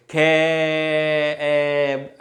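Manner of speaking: ख held on until only ए sounds; long pronunciation. Shown as a demonstration. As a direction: stretch ख into a long, level ए. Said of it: "यानी लंबे उच्चारण में भी". (ख को लंबा खींचकर ए तक)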